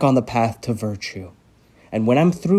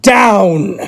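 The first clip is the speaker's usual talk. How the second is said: loud, high furious yell; enraged doctor screaming at the patient; shout; 9 semitones higher, 9 dB louder than usual. Acted. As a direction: scream the order at him.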